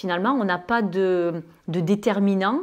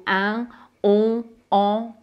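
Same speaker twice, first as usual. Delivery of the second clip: northern French pure nasal vowels; northern French accent; much slower than usual. Shown as a demonstration. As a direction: say the nasals as in northern France, no consonant tail. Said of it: "l'accent du nord". (accent du nord de la France)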